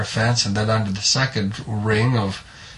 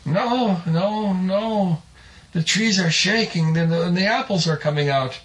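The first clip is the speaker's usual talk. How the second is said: agitated insisting; 7 semitones higher than usual. Acted. Man insists to disbelieving wife.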